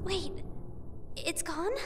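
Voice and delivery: soft, rising, barely above a breath; stunned question. Acted. Mayu finding her charm missing, quiet disbelief. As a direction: ask it quietly, not believing it yet.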